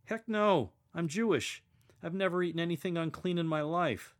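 Peter's protest. Retold or imitated. Peter retold refusing heavenly voice.

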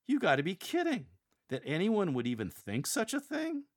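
high-pitched, scoffing disbelief; incredulous. Shown as a demonstration. Angels on trial for an absurd claim, incredulous. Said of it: "Express a demeanor as if to say".

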